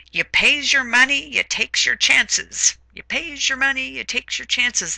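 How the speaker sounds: high sing-out of a barker; carnival barker. Retold or imitated. Teacher quoting a carnival barker from a novel, high barker's call. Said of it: "a carnival barker who had said".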